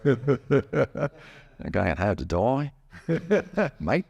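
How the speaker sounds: drawn-out Australian twang through laughter; Australian accent. Shown as a demonstration. Repeating the joke after a compliment, laughing Australian accent. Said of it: "pretty good, um, Australian accent".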